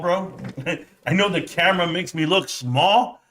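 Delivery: usual speaking voice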